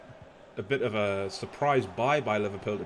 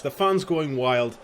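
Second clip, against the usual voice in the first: ordinary delivery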